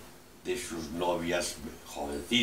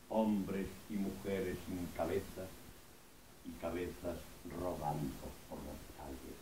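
hushed, fading verse in short phrases; soft, spaced-out solemn recital; poem recitation; 2 semitones lower, 10 dB quieter than usual. Acted. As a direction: let the poem sink softer to its end.

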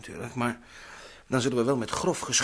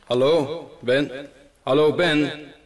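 louder call into the intercom; 7 dB louder, much slower than usual. Acted. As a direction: hail him over the line. Calling Ben over intercom. (luid oproepen via de intercom)

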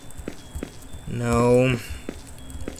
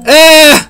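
high, loud jump-scare scream; startled scream; 12 semitones higher, 11 dB louder than usual. Real, not acted.

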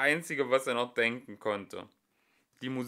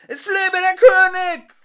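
very high, loud shout; crowd shouting; 16 dB louder than usual. Acted. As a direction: shout it like a cheering hall. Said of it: "Alles schrie immer wieder".

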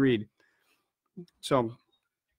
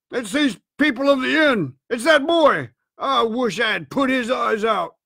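raised, higher vengeful voice; angry cry; 5 semitones higher than usual. A narrator's reading. Blind man blames the boy at the inn, crying angrily. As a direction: rail bitterly at the culprits. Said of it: "cried the blind man Pew".

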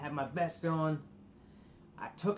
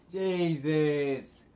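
loud exasperation; 8 dB louder than usual. Real, not acted. Exasperated at replacing his wallet.

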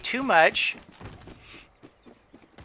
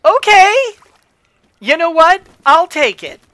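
excited; 9 semitones higher, 9 dB louder than usual. Real, not acted. Thrilled at working trick.

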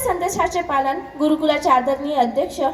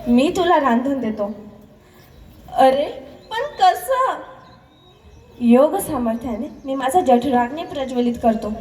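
lower, serene voice of a wise elder brother; calm yogi brother; 5 semitones lower than usual. Acted. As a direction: speak low and assured, like a saint. Dnyandev's voice in story. (शांत, धीरगंभीर ज्ञानदेवांचा खालचा आवाज)

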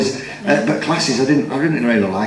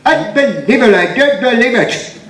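high exasperated Victor Meldrew catchphrase; slowed, outraged disbelief; 9 semitones higher than usual. Retold or imitated. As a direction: raise it up in disbelief like a grumpy pensioner. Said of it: "I like the one, the, the Victor Meldrew".

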